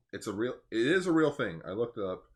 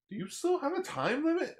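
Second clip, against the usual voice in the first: squeaky voiced question, far higher; high-pitched acted quote. Acted quote of show character.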